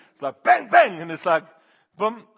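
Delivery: loud bang noises. Retold or imitated. Pastor acting out banging on the engine, loud mock bangs. Retelling tapping car parts.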